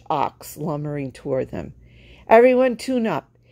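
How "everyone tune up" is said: high, louder call to the band. Acted. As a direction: shout the order out. Calling the band.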